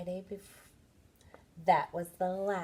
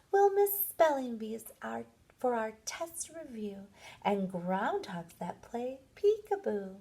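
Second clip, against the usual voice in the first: poem recital; 5 semitones higher than usual. Acted. Children reciting their farewell poem to the teacher, sing-song.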